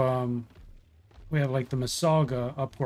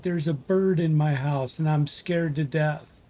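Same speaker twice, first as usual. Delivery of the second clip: ordinary talk, nothing marked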